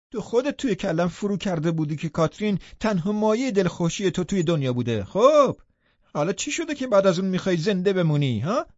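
high, loud mocking taunt; taunting outburst. Hindley jeering at Heathcliff through the window, high taunt. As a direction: jeer at him, voice climbing.

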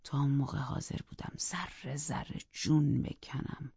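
plain reading voice

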